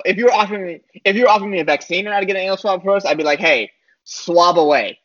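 ordinary talk

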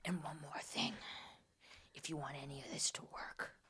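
grip them close and breathe a warning; menacing whisper. Retold or imitated. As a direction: psychic warning her to keep it secret. Psychic grabbing shirt, warning.

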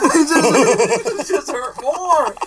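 loud, high wail through real laughter; pained, high laughing shout. Real, not acted. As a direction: complain while cracking up. In pain, laughing at it.

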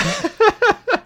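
loud high-pitched laugh. Real, not acted. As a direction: burst into laughter. Laughs at own game memory.

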